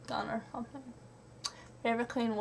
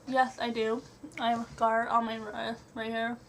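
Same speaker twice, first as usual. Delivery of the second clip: normal talking voice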